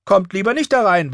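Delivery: put-on high, loud girl's threat; defiant child keeping intruders out; warning. A narrator's reading. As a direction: warn them off through the door. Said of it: "warnte sie".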